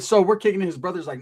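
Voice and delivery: ordinary talk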